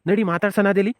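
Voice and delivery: ordinary delivery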